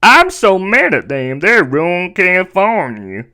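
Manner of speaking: hammed-up indignant impression, swooping pitch; exaggerated mocking imitation; mock politician voice. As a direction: put on a whiny, over-the-top complaint voice. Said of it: "That's literally how she talks".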